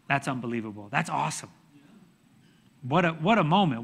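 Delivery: low, soft marvelling; quietly awestruck; hushed awe. Real, not acted. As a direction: drop to a quiet, amazed aside. Awed aside after the story.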